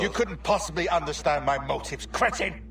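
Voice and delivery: angry outburst. Acted. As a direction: spit it out in contempt, pushing fast. Heated confrontation with the assassin.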